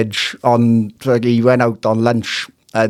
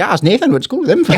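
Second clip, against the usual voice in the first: put-on excited local girls; 7 semitones higher, faster than usual. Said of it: "girls like".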